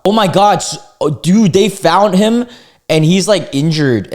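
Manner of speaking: loud, high, stunned outburst; real shock. Real, not acted. Reacting to the hanging man being found, loud shocked disbelief. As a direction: exclaim in disbelief, drawing out the words. Reacting to man's fall from helicopter.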